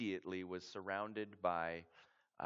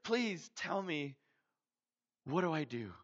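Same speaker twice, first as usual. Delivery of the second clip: much higher and louder, breathless and pleading; urgent wonder; 12 semitones higher, 12 dB louder than usual. Retold or imitated. Clyde telling his dream to the missionary, urgently pleading. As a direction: pour it out, desperate to know. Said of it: "And he said".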